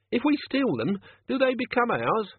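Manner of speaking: a dim crook asking innocently; naive henchman. Acted. Chicken Wire's naive question.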